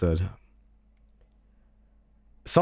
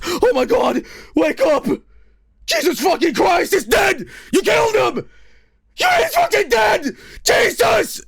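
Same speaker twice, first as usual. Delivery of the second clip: high, loud hammed-up screaming; mock-frantic shrieking, completely losing it; hysterical overreacting; 4 dB louder than usual. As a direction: wail as if your friend just died. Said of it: "crying and he's just fucking going nuts".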